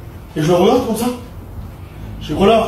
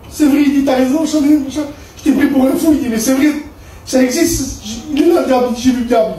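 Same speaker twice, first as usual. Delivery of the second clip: astonished outburst; 7 semitones higher than usual. Retold or imitated. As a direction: blurt it out like someone who just saw the devil. Cellmate shocked after seeing the devil.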